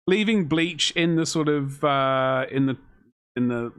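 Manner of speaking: normal talking voice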